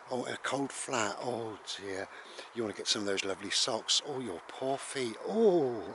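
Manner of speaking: hammed-up fussing, oohs and ohs; mock sympathy. Listener to habitual moaner, exaggerated mock sympathy. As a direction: coo over someone's tiny troubles.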